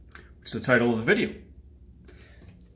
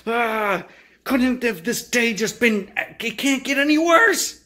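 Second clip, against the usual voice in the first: higher, exasperated ranting; acted anger; 7 semitones higher, slower than usual. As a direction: sputter with mock rage. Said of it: "You're angry".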